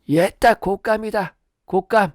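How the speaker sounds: high granny voice offering a treat; cheerful grandmother. Acted. Grandmother offering dried persimmon to the baby, high cheerful voice. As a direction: coax with a sweet granny lilt.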